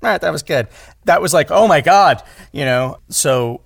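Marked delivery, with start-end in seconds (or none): 0.0-0.7 grudging coach praise. Retold or imitated. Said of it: "just to get her to go". 1.5-2.2 loud, high burst of relief at rare praise; mock thrilled gasp. Actor thrilled the tough coach approved, loud and high.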